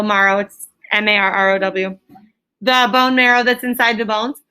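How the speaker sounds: ordinary talk, nothing marked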